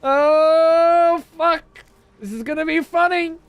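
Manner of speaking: high, loud, hammed-up imitation of gleeful viewers. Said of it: "I bet it hurt some of you to watch".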